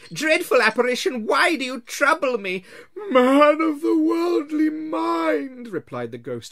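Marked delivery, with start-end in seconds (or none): 0.1-2.6 put-on higher, louder, pleading old man; frightened Scrooge. Scrooge on his knees begs the ghost, frightened. 3.1-5.7 high, slow, loud moaning spectre; wailing ghost. Acted. Marley's ghost wails at Scrooge, slow and loud.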